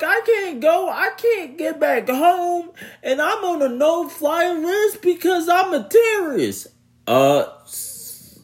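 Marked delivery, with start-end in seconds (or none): 0.0-6.7 high whiny mock sobbing voice; hammed-up high wail of a crying passenger; mock crying man. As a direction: whimper it out like a grown man crying. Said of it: "this dude started crying".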